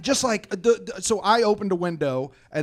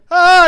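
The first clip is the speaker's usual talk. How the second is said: piercing high scream of pain; loud, high pained shriek; yell; 15 dB louder than usual. Retold or imitated. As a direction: shriek with both hands trapped. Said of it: "And I go".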